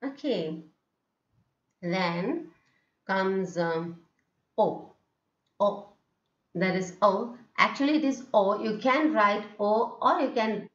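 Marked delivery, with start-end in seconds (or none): 4.6-5.9 "O" as a brief open "aw", not held; short O. Shown as a demonstration. Teacher introducing the vowel symbol, short O. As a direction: say each "O" short and separate. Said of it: "Then comes the prolonging of O".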